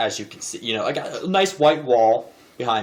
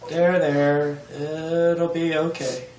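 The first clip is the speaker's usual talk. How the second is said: higher, louder flustered soothing; awkward comforting; 5 semitones higher, 4 dB louder than usual. Acted. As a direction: panic a little while patting them on the back. Awkwardly consoling a crier.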